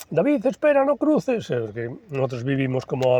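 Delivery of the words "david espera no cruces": high, anxious mother calling after her son; mother calling after her runaway son. Retold or imitated. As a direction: call him back like a worried mom. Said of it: "a mi madre".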